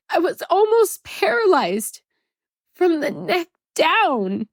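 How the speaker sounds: pushing the words out through real tears; tearful, high and strained, fighting to keep talking; crying. Real, not acted. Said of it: "stop crying so you can teach us".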